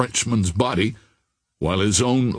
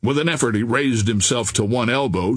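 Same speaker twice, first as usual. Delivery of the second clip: ordinary delivery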